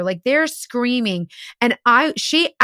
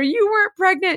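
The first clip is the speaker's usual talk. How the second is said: higher mock yell of the accusation; imitating husband yelling; 8 semitones higher than usual. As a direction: toss it back like the angry husband. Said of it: "The husband's yelling at her".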